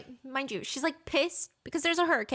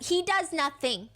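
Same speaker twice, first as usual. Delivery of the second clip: higher, slower contradiction; indignant retort; 5 semitones higher, slower than usual. Retold or imitated. Girlfriend dismissing her dad to boyfriend, indignant. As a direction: push back high and emphatic.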